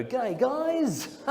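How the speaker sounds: high, awkward Aussie hello; awkward greeting. Retold or imitated. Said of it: "you have to very awkwardly go".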